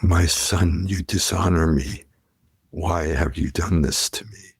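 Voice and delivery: low, grave mob-boss voice; Godfather. Acted. Mentor reproaching the student who turned him down, low Godfather impression. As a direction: play a wounded don reproaching his protégé. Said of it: "to the godfather".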